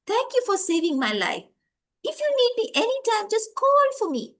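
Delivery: high, lively voice of a tiny insect; little dragonfly voice. Acted. Rescued dragonfly thanks the boy, high little creature voice. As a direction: pitch it up as a small grateful creature.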